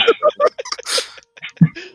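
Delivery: high, loud real laugh; burst of laughter. Real, not acted. GM bursts out laughing at the players' joke, loud and high. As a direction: cracks up loudly.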